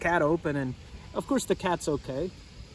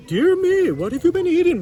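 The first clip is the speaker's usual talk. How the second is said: surprised exclamation; 12 semitones higher, 9 dB louder than usual. Acted. Parson greets the fat cat.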